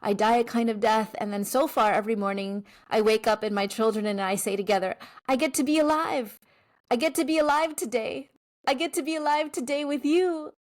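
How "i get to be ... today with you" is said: joyful family affirmation. Retold or imitated. Morning affirmation said with children.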